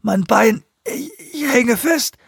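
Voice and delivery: high, drawn-out whimpering; whining. A narrator's reading. Rubin tells Lee his leg is stuck, whining. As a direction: wail in pain and helplessness. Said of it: "jammerte Rubin".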